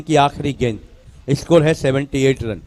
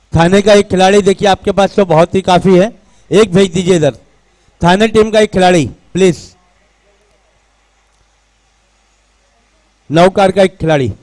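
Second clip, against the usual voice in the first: raised, higher-pitched PA summons; calling out; 3 semitones higher, 5 dB louder than usual. Real, not acted. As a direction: call players over loudly on the PA. Calling players to commentary box.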